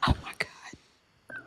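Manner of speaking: shocked whisper. Real, not acted. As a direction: breathe it out in disbelief. Shocked at the roast.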